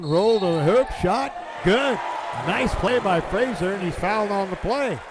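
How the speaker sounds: rising, loud play-by-play burst on the score; excited call. Real, not acted. Announcer calling the scoring drive, loud and climbing. Big made basket call.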